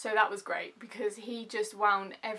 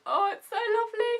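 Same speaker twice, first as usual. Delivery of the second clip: high, loud delighted squeal; big swooning "aww" delivery; gushing; 13 semitones higher, 9 dB louder than usual. Acted. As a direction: gush over happy news in a soaring voice. Gushing over the engagement news.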